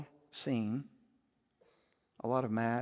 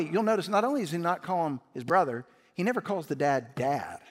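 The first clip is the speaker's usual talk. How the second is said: ordinary talk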